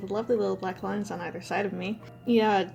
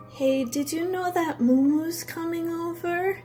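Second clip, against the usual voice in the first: high, slow and dreamy, half asleep; drugged loopy voice; 8 semitones higher, slower than usual. Retold or imitated. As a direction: sound woozy on painkillers. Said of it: "she wakes up and says".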